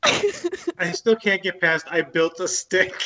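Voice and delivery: real giggling, struggling to repeat a joke; talking through laughter. Real, not acted. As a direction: barely get it out while laughing. Cracking up quoting earlier line.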